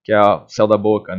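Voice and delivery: normal talking voice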